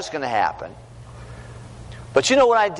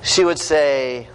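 usual speaking voice

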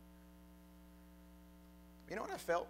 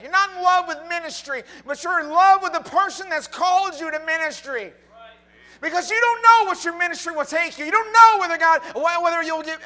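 preaching at full force; 11 semitones higher, 20 dB louder than usual. Acted. Preacher urging the congregation to love Jesus, shouting. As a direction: preach it to the rafters. Sermon climax.

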